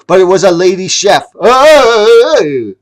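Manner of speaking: high, loud and fast gasp of shock; shocked reveal. A narrator's reading. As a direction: blurt the surprise.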